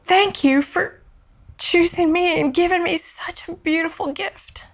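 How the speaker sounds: high voice choked with tears; tearful, sobbing. Retold or imitated. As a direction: retell tearful thanks, fighting back sobs. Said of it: "I'm just sobbing".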